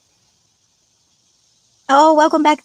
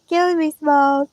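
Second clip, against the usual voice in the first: Killing me Smalls quote; 5 semitones higher than usual. Retold or imitated. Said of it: "I can hear you saying it".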